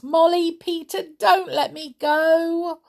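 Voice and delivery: high, loud, pleading brownie; begging his friends not to let him go; wailing plea. A narrator's reading. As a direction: Winks wailing to Molly and Peter. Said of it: "wailed Winks".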